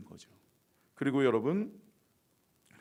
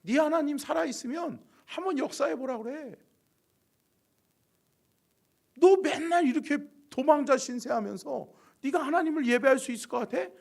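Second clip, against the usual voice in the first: higher-pitched jeering; scornful taunting; 10 semitones higher, 3 dB louder than usual. Acted. Enemies slandering fugitive David and his God, scornful. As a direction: taunt him with a raised, needling voice. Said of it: "대적자들이 그렇게 비방하는".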